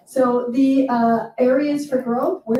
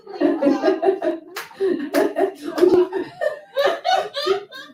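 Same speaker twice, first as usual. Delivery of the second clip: real laugh; 9 semitones higher than usual. Real, not acted. Laughs at audience remark.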